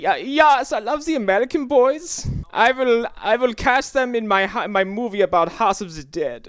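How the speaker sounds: Uwe Boll impression.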